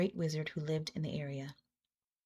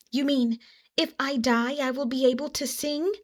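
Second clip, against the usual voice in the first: amazed; 6 semitones higher, 4 dB louder than usual. A narrator's reading. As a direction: ask it in hopeful amazement. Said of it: "asked the armadillo in amazement".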